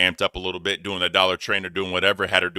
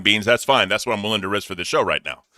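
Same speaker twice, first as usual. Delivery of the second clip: normal talking voice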